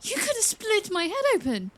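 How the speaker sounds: high, alarmed reproach; shaken protest. Acted. Princess scolding the mentor after the strike, high and alarmed. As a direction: still rattled, protest it.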